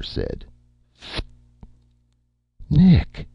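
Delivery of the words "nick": high, loud gasped cry; gasping. A narrator's reading. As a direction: gasp his name in shock. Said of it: "Erica gasped".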